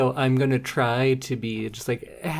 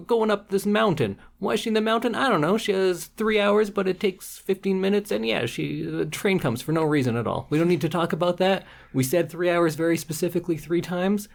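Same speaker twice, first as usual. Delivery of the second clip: higher, breezy dismissive writer; mocking writer voice; 6 semitones higher than usual. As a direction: brush off every plot hole, pitched up. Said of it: "And then the writer says".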